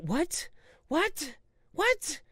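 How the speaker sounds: climbing, baffled whats; rising disbelief. Real, not acted. Baffled by the clip.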